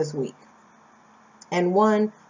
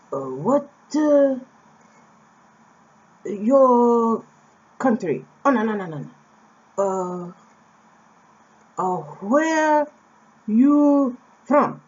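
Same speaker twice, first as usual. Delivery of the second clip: halting, higher and louder imitation of an old man; old man's broken English; 4 semitones higher, 4 dB louder, slower than usual. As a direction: stumble over the English like a puzzled old man. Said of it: "and he says".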